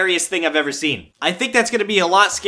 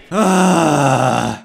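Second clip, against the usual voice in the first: heavy sigh; 4 semitones lower, 4 dB louder than usual. Real, not acted. Disappointed after losing game.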